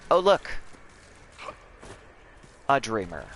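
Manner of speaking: ordinary talk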